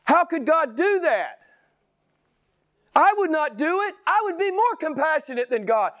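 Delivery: high-pitched mock protest; indignant objector. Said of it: "but you get this undercurrent".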